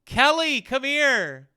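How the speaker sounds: calling Kelly loud and high; shrill valley girl call. Pretend. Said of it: "Wrong accent".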